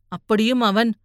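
usual speaking voice

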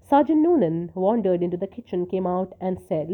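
plain reading voice

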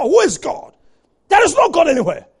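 loud, high denial; defiant atheist. Retold or imitated. Atheist who printed books denying God, loudly defiant. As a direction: proclaim it forcefully as the unbeliever.

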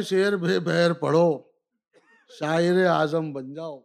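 measured, declaimed verse lines; stately nazm recitation. Acted. Recites nazm Aisi Taisi.

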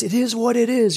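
high, dreamy, grateful tone; blissful. Retold or imitated. Recalling his grateful reply at ceremony, high and dreamy. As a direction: say it floating in gratitude. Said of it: "And the way I said it, it was".

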